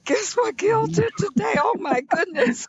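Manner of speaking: high-pitched, laughing mock alarm; shocked relatives. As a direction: imitate scandalized family spreading the news, voice shooting up. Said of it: "my family members were so shocked".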